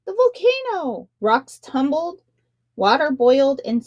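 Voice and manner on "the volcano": high alarmed call; crying out. A narrator's reading. Punia shouting a false alarm at the shark king, high cry. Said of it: "he cried".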